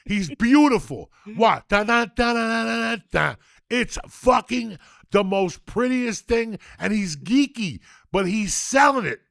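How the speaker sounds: loud, high, shouting delight in a comedy scene; ecstatic raving. Real, not acted. Man raving to friends about Pee-wee's dance, shouting with glee. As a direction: hammer home your love for the scene, near shouting.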